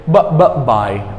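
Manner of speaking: "b, b, bi" lip pops into the first syllable; sound drill. Shown as a demonstration. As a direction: drill the "bi" of "biofuel". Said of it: "We say b".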